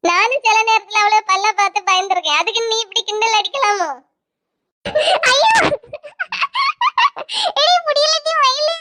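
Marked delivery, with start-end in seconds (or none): 5.3-8.8 higher, slower, laughing through the words; can't stop laughing at her. Acted. Brother laughing at his sister's teeth while speaking. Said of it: "ரொம்ப சிரிக்காதல".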